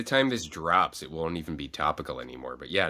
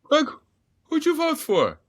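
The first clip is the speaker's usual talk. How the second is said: high, loud call to the husband; imitating mom calling out; 7 dB louder, slower than usual. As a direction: mom shouting across the house, pitched way up. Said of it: "And she goes".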